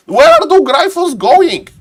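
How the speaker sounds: high, loud caster's cry; excited alarm. Real, not acted. Caster watching the Gryphons fly off, excited alarm. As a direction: call it out in startled excitement.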